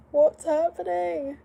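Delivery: high, loud, slow bewildered question; high drawn-out what's happening; surprised. Real, not acted. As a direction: call out in confusion.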